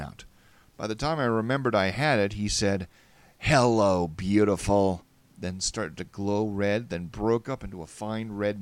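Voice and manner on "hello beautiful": loud greeting in a put-on voice; taunting stranger. A narrator's reading. Splicer surprising the player from behind, loud taunt. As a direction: greet loudly as the menacing figure right behind.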